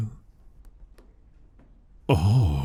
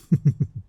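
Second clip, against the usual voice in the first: teasing laugh; 6 semitones higher than usual. Acted. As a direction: laugh at how red they got. Teasing incubus laughs again.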